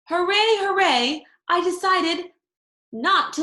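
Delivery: high, loud little hooray cheers; cheering. A narrator's reading. Junie cheers her decision to keep her tooth. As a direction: cheer for yourself.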